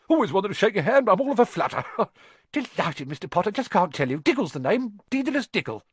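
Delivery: high-pitched giddy little man, tittering; fluttery, excited Diggle. Acted. Fluttery Diggle introduces himself.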